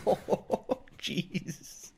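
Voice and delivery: breathy laugh into oh jeez. Real, not acted. Cracking up at joke.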